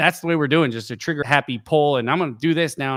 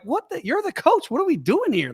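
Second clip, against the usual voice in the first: high, stunned mock disbelief; incredulous teammates asking the coach what's going on; disbelieving players; 13 semitones higher than usual. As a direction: act out baffled players facing the coach. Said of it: "We're like".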